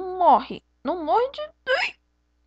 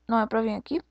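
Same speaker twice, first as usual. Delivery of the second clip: ordinary talk, nothing marked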